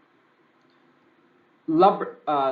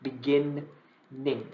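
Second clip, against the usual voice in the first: BEGINNING very slow, broken into begin-NING; stressed spelling pronunciation; much slower than usual. Shown as a demonstration. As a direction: stretch out beginning so both n's sound. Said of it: "stress niyo yung, yung pronunciation".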